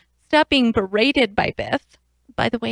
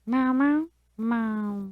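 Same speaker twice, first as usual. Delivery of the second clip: string of soft playful meows; cat meow; 4 dB quieter, much slower than usual. Pretend. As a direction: let the cat answer in gentle meows. Cat names its father.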